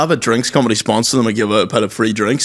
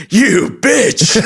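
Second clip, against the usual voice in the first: mock angry mom; 11 semitones higher than usual. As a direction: yell it like a furious mum, half in jest. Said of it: "my ma was like".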